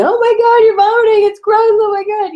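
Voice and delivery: very high, loud, shrieking disgust; disgusted freakout. Retold or imitated. Mocking a partner who recoils from a sick spouse with a shriek. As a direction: shriek it like you're grossed out. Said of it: "Instead of going".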